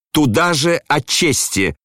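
slow, high-pitched malicious jeer; spiteful cry. A narrator's reading. Son mocking his father's talk of honour, spiteful cry. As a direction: throw it back high and spiteful. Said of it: "крикнул он злобно".

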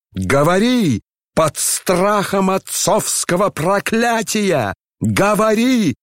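very high, stretched-out commands; frenzied roar. A narrator's reading. General threatening his son with a curse, frenzied roar. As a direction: push the pitch far up in fury. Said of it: "ревел генерал в совершенном выступлении".

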